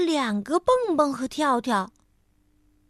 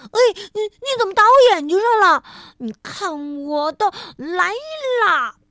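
high, loud, fast outraged squeal; playful protest; 7 semitones higher, 7 dB louder than usual. A narrator's reading. Frog hit in the eye by a snowball, mock-outraged shout.

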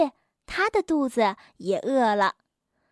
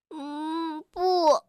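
pained groan. A narrator's reading. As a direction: groan weakly as if your belly aches. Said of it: "跳跳痛苦地呻吟着". (痛苦地呻吟)

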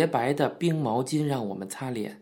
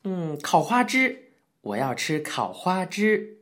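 put-on slow, whiny kid demand; a spoiled boy wheedling for his dish; childish clamor; 4 semitones higher, slower than usual. A narrator's reading. Apprentice demands grilled squid, slow childish whine. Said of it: "小玉嚷嚷道".

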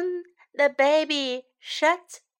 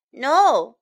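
sharp loud no from mom; a quick, loud warning no; loud firm no; 7 dB louder than usual. Acted. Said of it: "says Mom".

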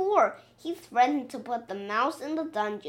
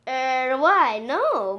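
usual speaking voice

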